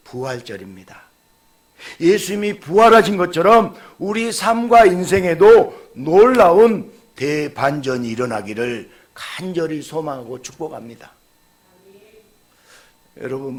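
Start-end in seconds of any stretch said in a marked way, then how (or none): 2.0-11.1 loud, high, ringing proclamation; full-force preaching. Acted. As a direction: proclaim it to the whole congregation. Closing Easter blessing. (힘찬 설교조)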